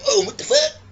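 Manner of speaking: startled Hashirama. Retold or imitated. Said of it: "Hashirama's like".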